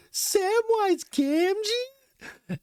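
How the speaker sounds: very high, very slow stretched call; mock yell. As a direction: call the name out long and high. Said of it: "And then I just yelled".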